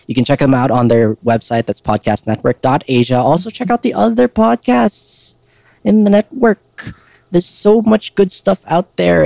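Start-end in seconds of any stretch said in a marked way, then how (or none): 3.2-9.2 slow, drawn-out announcer read; slow promo read. Acted.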